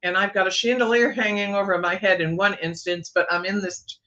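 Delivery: normal talking voice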